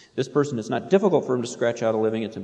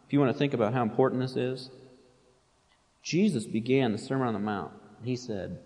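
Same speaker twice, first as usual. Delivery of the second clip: ordinary delivery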